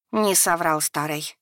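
ordinary delivery, nothing marked

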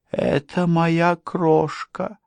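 high, slow possessive grumble; high-pitched, drawn-out menace; growling madman. A narrator's reading. Madman claims his darling, high slow grumble. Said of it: "проворчал он".

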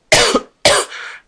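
rough chesty coughing; coughing fit. Real, not acted. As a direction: cough it out before apologizing. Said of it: "desculpa, estou cheia de tosse".

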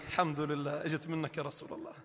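quiet mock relief; mock relieved murmur. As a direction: mutter the relief under your breath. Relieved excuse-maker voiced.

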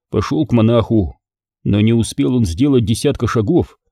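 ordinary delivery

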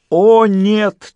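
high, loud melodramatic wail; stagey, overdone dismay; theatrical cry. A narrator's reading. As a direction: cry out in hammed-up horror. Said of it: "театрально вскричал Фист".

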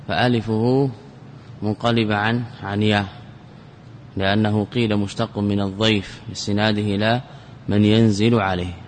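ordinary talk, nothing marked

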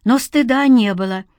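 ordinary delivery, nothing marked

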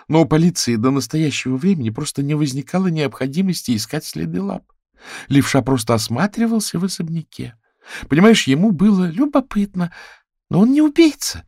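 high, insistent character voice; chatty raccoon woman, protesting. Acted. Raccoon defends her lover from suspicion, high and insistent. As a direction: build to a very high, loud protest.

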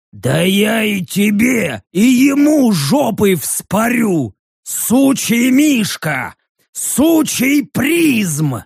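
slow, high straining snarl; angry threat. A narrator's reading. Orc threatens prism and bear, angry straining growl.

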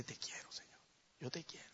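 hushed, shy whisper; whispered confession. Acted. Peter to Jesus, a timid whisper. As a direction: barely dare to say it.

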